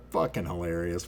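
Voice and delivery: low, soft amused aside; a lightly voiced Robert, quietly tickled; understated chuckling friend; imitating Robert. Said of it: "Robert's sitting here going".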